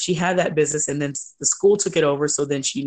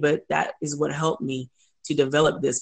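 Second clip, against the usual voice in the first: usual speaking voice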